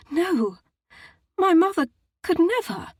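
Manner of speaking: high, loud protest of disbelief; shocked denial. A narrator's reading. Daughter defends her mother, shocked and high. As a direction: cry out the denial.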